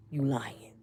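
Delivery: breathless whispered disbelief; stunned whisper. Retold or imitated. [name] whispering in shock at who came, stunned whisper. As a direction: barely say it, floored.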